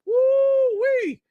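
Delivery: very high drawn-out falsetto whoop; squealed exclamation at a heavy blow; whooping "woo-wee". Real, not acted. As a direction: whoop in high-pitched astonishment. Exclaims at the guilt trip.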